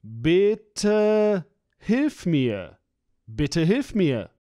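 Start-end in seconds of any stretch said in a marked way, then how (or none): none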